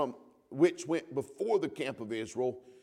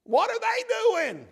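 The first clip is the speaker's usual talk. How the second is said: shouted high in astonishment; amazed shout; 8 dB louder, slower than usual. Retold or imitated. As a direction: cry out in wonder at the sight. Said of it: "you gotta say".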